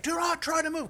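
high, loud mock-paramedic shout; imitating a shouted order; retold urgent yell. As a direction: call out an urgent warning. Retold paramedic yelling from afar.

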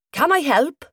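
put-on broad Welsh accent. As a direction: greet her in a broad Welsh lilt. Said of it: "said Chester in a broad Welsh accent".

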